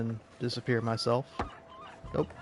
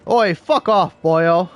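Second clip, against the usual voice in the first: put-on louder, rough Welsh-accented brush-off; imitating a Welsh gang member, louder, Welsh accent; mock Welsh accent; 5 semitones higher, 9 dB louder, slower than usual. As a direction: tell them off like a hammed-up Welsh bloke. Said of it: "Welsh is like".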